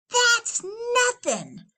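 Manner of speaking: high, slow brag; cocky little teen. Acted. Littlest teen, high slow brag. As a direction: shrug it off. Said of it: "said the littlest teen".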